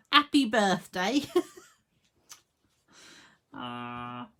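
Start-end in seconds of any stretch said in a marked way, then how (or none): 0.1-1.2 happy birthday said without the H, as "appy birthday", laughing; dropped H. Shown as a demonstration. Crafter laughing as she drops the H from Happy. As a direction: drop the H on "Happy" and giggle. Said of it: "we will leave room for the H".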